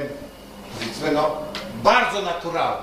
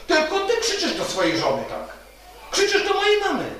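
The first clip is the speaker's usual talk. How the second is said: high, retold accusing cry; high-pitched, mildly upset reproach; reproachful son; 9 semitones higher than usual. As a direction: act out a son confronting his father. Son reproaches father in retold quarrel.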